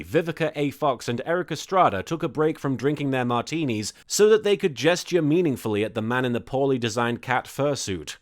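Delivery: usual speaking voice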